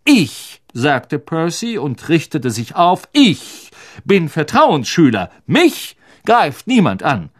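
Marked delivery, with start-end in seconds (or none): 0.0-0.6 high, emphatic self-important word. Acted. Percy draws himself up. 3.1-7.3 higher-pitched pompous declaration; self-important. Acted. Percy pulls rank.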